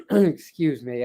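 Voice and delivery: ordinary talk, nothing marked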